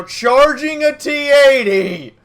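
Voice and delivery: very loud, high, slow hype; excited shout. Real, not acted. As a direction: shout with disbelief and glee. Excited commentary on charge.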